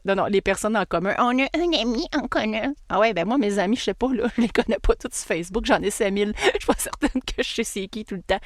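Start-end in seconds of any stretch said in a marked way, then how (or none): 1.2-2.7 whiny put-on voice for a lame excuse; silly mock voice; mocking imitation. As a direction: mimic someone mockingly.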